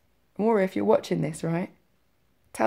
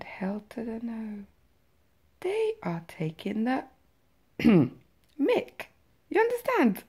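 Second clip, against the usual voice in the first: retold rising anger; 5 semitones higher, slower than usual. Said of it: "the fire in the pit of my stomach".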